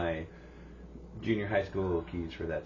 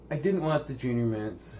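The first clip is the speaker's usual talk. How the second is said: ordinary delivery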